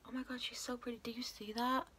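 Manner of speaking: awed whisper. Real, not acted. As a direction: whisper in awe. Admiring the shade.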